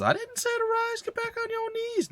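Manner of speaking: very high, thin-voiced haughty order; mock-imperious falsetto to the kneeling chief; squeaky mock command. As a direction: squeak it like a spoiled little prince.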